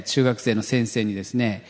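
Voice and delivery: ordinary talk, nothing marked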